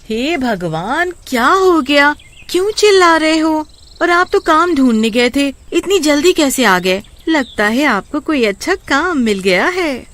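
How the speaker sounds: high-pitched wife, fussing and questioning; wife startled by husband's noisy return. Acted. Wife answers husband's shouting.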